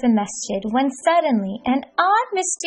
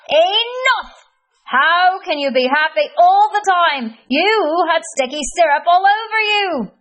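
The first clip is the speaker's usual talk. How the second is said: high, loud, exasperated grump; frustrated Cranky Kareem; 8 semitones higher, 4 dB louder than usual. Acted. Cranky Kareem berating Hamdi from the bush, high frustrated squeal. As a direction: squeal it out, fed up and disbelieving.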